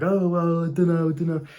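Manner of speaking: lightly voiced girl, unsure and evasive; a mild hint of a girl dodging the invite; hesitant girl making excuses. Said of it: "it leaves her open to excuses".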